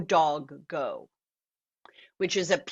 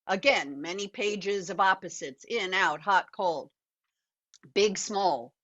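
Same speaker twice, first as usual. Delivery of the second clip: normal talking voice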